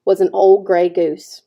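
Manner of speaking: usual speaking voice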